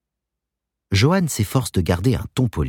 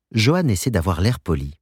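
plain reading voice

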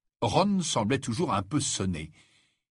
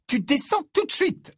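very high, louder motherly command; stern order; 5 dB louder than usual. Acted. Mother orders Ginny downstairs, sharply commanding. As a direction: order her down with no room for argument. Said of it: "ordonna Mrs Weasley".

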